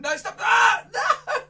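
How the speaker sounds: high loud ah, no; hammed-up squeal; mock yelp. Magician to the wand, high mock yelp. Wand under his nose again.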